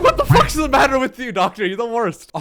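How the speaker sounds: high, loud indignant outburst; hammed-up exasperated scolding; mock outrage. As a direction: yell at the doctor in comic disbelief. Acted outburst at the doctor.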